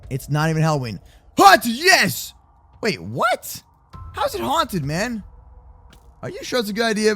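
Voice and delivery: ordinary talk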